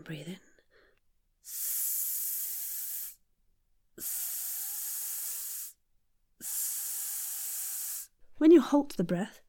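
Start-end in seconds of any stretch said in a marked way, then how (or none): 0.0-0.4 soft whispered instruction; whispered breath cue. Shown as a demonstration. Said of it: "So, for example". 1.4-8.1 S as short, controlled hisses; controlled hissing S. Shown as a demonstration. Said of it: "we're going to control the outburst of the s".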